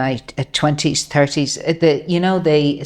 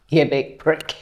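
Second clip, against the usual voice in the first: put-on Northern accent; 3 semitones lower than usual. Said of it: "We'll do that in Northern accent".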